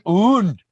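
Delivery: "und" mimicked high and loud; mock how he said "und"; imitating und. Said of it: "You were like".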